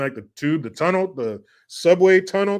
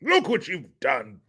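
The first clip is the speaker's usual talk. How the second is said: slow, high, rising British outrage; hammed-up posh horror, building louder; mock posh scolding; 9 semitones higher, 4 dB louder, slower than usual. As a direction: scold in an exaggerated upper-crust voice. Acting posh scolding of Baxter.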